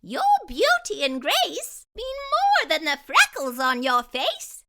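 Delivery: playful magical fairy teasing gently. Acted. Fairy coaxing Jessie to look, high playful voice. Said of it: "said the fairy".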